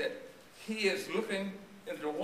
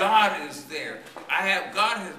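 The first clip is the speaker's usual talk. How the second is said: louder, slow, proclaiming; preaching emphasis; 8 dB louder than usual. Real, not acted. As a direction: hammer it home from the pulpit. Preacher stressing God's presence.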